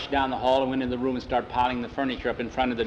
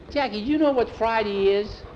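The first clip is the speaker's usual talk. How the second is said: put-on excited boy; 9 semitones higher, 4 dB louder than usual.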